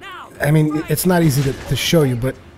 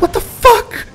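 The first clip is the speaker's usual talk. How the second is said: shrieking, much higher and louder; shocked yelp; 5 dB louder, slower than usual. Retold or imitated. Dragon chases the overloaded player, shrieked shocked yelp. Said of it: "and I'm like".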